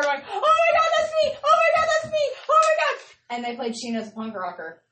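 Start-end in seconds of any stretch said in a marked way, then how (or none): 0.3-3.0 very high, loud fangirl shriek; fangirl squealing. Retold or imitated. Fan recalling being named in the band's video, fangirl squealing. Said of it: "squealing like a fangirl".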